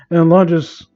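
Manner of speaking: ordinary talk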